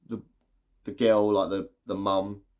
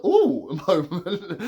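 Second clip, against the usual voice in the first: high, louder laughing gasp; 5 semitones higher, 4 dB louder, slower than usual. Retold or imitated. Reviewer reacting to the silly scene, laughing oh. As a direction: burst out amused. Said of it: "I was like".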